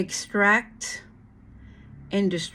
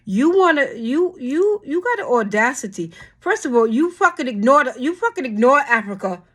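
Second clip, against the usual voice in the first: higher, louder, hammering each word; angry rant; 5 semitones higher, 5 dB louder than usual. Real, not acted. Scolding lawmakers for ignoring Africa, furious. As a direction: tear into them.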